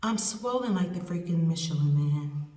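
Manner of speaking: usual speaking voice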